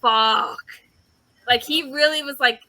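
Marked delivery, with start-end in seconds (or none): 0.0-0.6 a short loud mock curse; half-acted dismay; mock realization curse. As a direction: spit out one word as it dawns on him. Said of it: "He's like".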